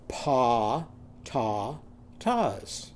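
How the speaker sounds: pa, ta, tas said as separate syllables with ah; syllables broken apart. Shown as a demonstration. Teacher modelling pa ta tas syllable by syllable. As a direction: tap out pa ta tas. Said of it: "Say this after me".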